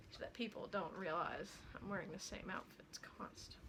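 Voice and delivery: whispered confession. Real, not acted. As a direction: lean in and whisper it. Whispered aside.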